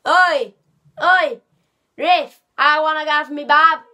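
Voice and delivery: second stab at Australian, loud and badly faked; bad Australian accent. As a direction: yell a hammed-up Aussie complaint. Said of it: "that's not a good Australian accent".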